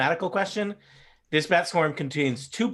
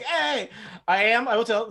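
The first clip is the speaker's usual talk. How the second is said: fake Boston-New Jersey accent; 6 semitones higher, 3 dB louder than usual. Shown as a demonstration. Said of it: "fake Boston slash New Jersey accent".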